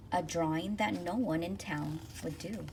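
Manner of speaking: ordinary delivery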